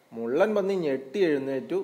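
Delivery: plain reading voice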